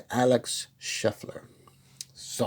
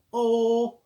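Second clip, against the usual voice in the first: excited oh; 12 semitones higher than usual. Real, not acted. Sudden excited reaction.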